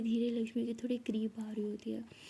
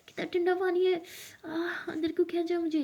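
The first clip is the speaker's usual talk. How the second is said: cold shock; 7 semitones higher than usual. Acted. Lakshmi pulled into the cold pool, high shocked complaint. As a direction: yelp at the icy water.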